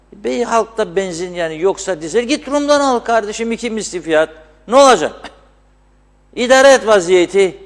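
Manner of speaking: high, loud offhand brush-off; dismissive official. Retold or imitated. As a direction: wave the complaint away carelessly. Officials shrugging off fuel shortage. (umursamaz, yüksek sesli geçiştirme)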